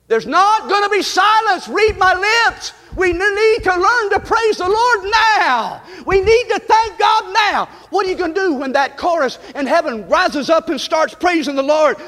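fiery revival preaching; preaching at full force. Acted. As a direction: whoop it up from the pulpit. Preacher builds to full shout.

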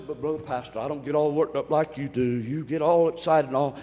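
low mock objector's voice; lightly acted low-voiced complaint; imitated church member. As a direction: drop the pitch to mimic a grumbling listener. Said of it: "now I know somebody says".

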